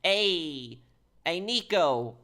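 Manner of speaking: lightly put-on accent, calling out a greeting; Phil Bell's accent. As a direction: imitate a game character's accented hello. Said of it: "I can do Phil Bell's accent".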